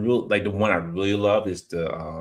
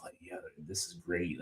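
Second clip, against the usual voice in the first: retold in a near whisper; hushed quote; 3 semitones lower, 15 dB quieter than usual. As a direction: breathe it out, barely voiced. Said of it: "It's like".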